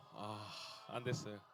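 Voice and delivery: low, slow, softened admission of defeat; deflated. Retold or imitated. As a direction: let it sink out, dropping low and quiet. Retelling his failed booking, deflated. (낙담한 듯 낮고 느리게)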